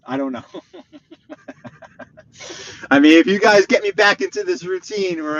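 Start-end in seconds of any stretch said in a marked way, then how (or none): 0.5-2.9 long, soft wheezy chuckle; laugh. Real, not acted. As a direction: trail off into quiet giggles. Laughs after saying he doesn't know.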